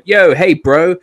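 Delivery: imitating a sudden bro tone; mock bro greeting. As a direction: ham up a too-casual hello. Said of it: "immediately going".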